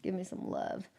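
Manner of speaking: a soft whisper, voicing the plant begging for care. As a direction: breathe it as the sick plant asking for love. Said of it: "she's like".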